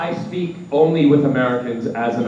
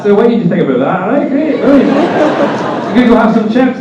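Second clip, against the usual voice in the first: broad Scottish chat, louder and higher; Scottish accent; 9 semitones higher, 7 dB louder, faster than usual. Retold or imitated. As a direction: slip into a cheery Glasgow Scottish accent. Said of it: "it's like that".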